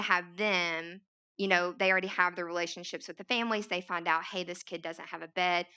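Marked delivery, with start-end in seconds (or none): none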